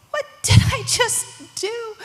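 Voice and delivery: high, loud alarmed voice; sudden panic. Acted. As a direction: realise your mistake in acted alarm. Peter panics on the waves.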